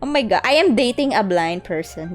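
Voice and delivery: ordinary talk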